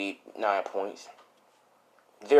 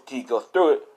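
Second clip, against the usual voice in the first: plain reading voice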